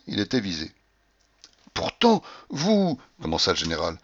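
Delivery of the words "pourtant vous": tentative objection. A narrator's reading. General begins a hesitant objection.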